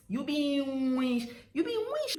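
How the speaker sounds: high "You be witch", ending "weesh"; drawn-out pronunciation. Shown as a demonstration. Demonstrating the Warri insult, high and drawn out. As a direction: pitch "witch" high with a long sh. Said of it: "They draw it like".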